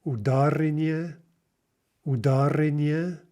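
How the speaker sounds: "ударение" with the stress moved onto the second syllable, да; misplaced stress. Shown as a demonstration. Teacher demonstrating a mistake to learners, "ударение" wrongly stressed on да. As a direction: lean on ДА in "ударение". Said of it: "let's practice mispronouncing, misplacing the stress".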